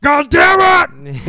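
a furious slow bellow of frustration; mock angry shout. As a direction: yell it slow like a man losing it. Imitating angry reaction.

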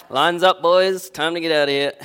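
loud, high joking order to leave; mock boss call. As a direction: shout it like packing up early. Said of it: "No, no, I'm just kidding".

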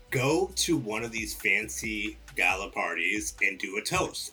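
usual speaking voice

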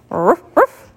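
two quick barks; puppy ruff. A narrator's reading. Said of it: "went Willie".

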